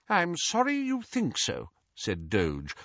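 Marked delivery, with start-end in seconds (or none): 0.1-1.7 high-pitched, coolly offended reply; cold, stiff politeness. A narrator's reading. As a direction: answer with restrained offence. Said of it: "more coldly still".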